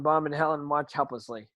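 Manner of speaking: normal talking voice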